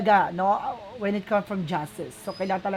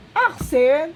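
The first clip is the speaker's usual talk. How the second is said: surprised echo; 6 semitones higher, 4 dB louder than usual. Real, not acted. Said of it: "madam, may accent ka".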